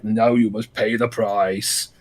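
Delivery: low, soft and slow, grave and far off; solemn avenger voice. Retold or imitated. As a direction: intone it like a doom-laden hero. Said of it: "And Knuckles like".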